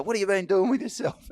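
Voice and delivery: high, loud and chummy; eager fan question. Retold or imitated. Fans quizzing the supposed footballer eagerly. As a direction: gush at him like an old fan. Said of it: "They go".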